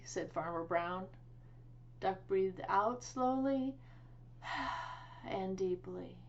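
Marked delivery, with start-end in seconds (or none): 4.4-5.0 soft unvoiced meditative sigh; long slow exhale. A narrator's reading. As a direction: breathe out audibly and slowly. Duck breathes out.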